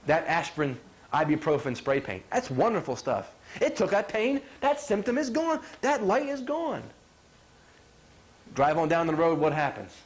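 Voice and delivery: usual speaking voice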